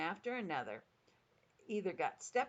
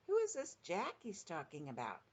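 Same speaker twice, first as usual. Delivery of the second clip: usual speaking voice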